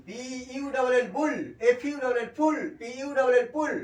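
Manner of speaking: high, loud spelling drill landing on বুল, ফুল, পুল. Shown as a demonstration. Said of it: "ইউ plus L".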